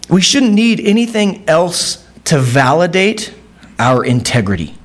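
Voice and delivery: ordinary talk, nothing marked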